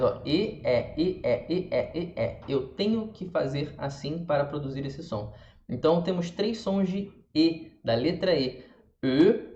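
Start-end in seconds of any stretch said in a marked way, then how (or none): 0.2-2.5 E alternately closed "ê" and open "é"; quick drill swinging E from closed to open; alternating E sounds. Shown as a demonstration. Teacher showing the mouth movement, closed and open E. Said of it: "E, que abre mais". 9.0-9.5 E as a rounded "eu" sound; unaccented French E. Shown as a demonstration. Teacher listing three E sounds, rounded unaccented E. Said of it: "sem nada".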